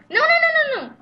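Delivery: very high frantic cries; panicked shouting. Real, not acted. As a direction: shriek in alarm. Panic in game.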